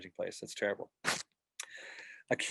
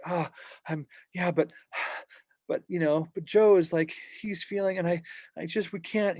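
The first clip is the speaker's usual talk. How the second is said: higher, flustered, sighing overwhelmed voice; overwhelmed shepherd; 7 semitones higher than usual. Retold or imitated. Imitating a shepherd weighed down by everyone's needs, higher and flustered. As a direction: fret it out, pitched up and sighing. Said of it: "Don't get overwhelmed".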